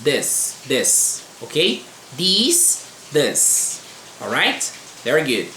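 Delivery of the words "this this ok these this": "this" short and open, "These" long "ee", then "this" again; pronunciation drill. Shown as a demonstration. Teacher drilling the two vowels for students, careful pronunciation. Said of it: "em inglês é o som de E".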